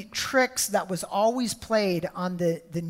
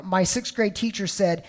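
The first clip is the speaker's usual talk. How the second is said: ordinary delivery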